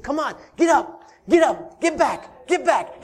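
rising, loud urging to get up; cheerleader God. Acted. God as cheerleader urging the fallen up, loud and eager. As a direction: cheer them back on their feet. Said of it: "He's your cheerleader".

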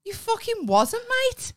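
high, incredulous retort; mock disbelief. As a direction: throw it back at him, teasing. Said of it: "I was like".

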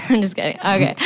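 normal talking voice

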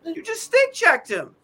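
high, slow, incredulous repeat; exasperated scolding. Retold or imitated. Fan scolding a player who stick checked, exasperated.

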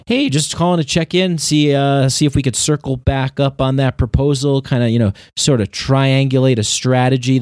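high, chirpy sales-call patter; salesman voice. Retold or imitated. Former salesman imitating a sales call, high and chirpy. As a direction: pitch it up like a corporate salesman checking in. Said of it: "They always talk like that in sales".

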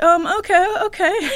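squeaky high, meek okay; timid server. Retold or imitated. Server backing down from Vader, squeaky and meek. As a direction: shrink back like a nervous canteen worker. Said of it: "And he's like".

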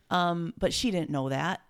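usual speaking voice

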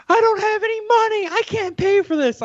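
gasp, then a very high panicked wail; imitated hysterical screaming. Storyteller voicing injured skater fearing the bill, hysterical. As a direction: mimic her in high, frantic distress. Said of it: "like, screaming".

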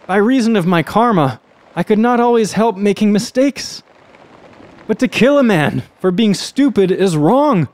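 high, loud desperate plea; condemned man pleading. Acted. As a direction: cry it out, pitched high and urgent. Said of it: "the condemned man cried out to him".

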